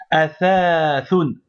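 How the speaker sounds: athathoun said very slowly with the fatha held; long fatha demo. Shown as a demonstration. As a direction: athathoun stretched out so the long a is heard. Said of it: "il faut prolonger le fatha". (athathoun, en prolongeant la fatha)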